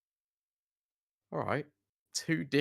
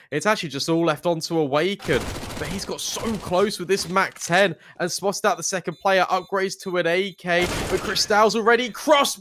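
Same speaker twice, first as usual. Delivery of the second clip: rising hype, louder and higher; esports caster; 6 semitones higher, 6 dB louder than usual. Real, not acted. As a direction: call the round with building energy. Play-by-play of a live round.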